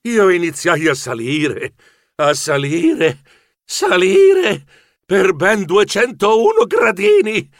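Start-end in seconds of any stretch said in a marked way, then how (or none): 2.2-7.5 slow, effortful build to a high exclamation; labouring climber. A narrator's reading. Geronimo climbing two hundred and one steps, labouring and exclaiming. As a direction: drag out each repeat, rising into a pained outcry.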